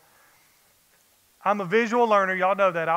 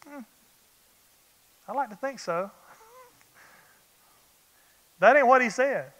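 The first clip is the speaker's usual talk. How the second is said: usual speaking voice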